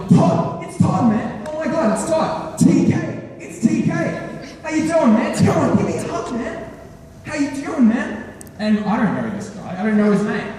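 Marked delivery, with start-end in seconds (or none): 0.1-8.3 high-pitched, overjoyed imitation of a friend; excited greeting. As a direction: greet a long-lost pal with gushing excitement. Acting out overexcited friend greeting him.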